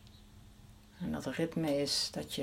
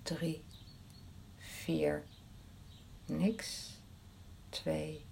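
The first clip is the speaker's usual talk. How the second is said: fading whispered count; 6 dB quieter, much slower than usual. Shown as a demonstration.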